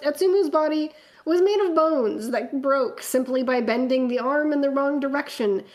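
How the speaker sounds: measured, dramatic fanfic reading; story narration. A narrator's reading. Reading a fanfic aloud to friends, dramatic narration.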